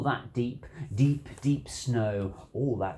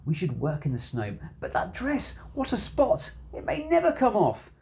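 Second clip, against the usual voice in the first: Sally's dismay; 6 semitones higher than usual. A narrator's reading. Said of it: "Sally said".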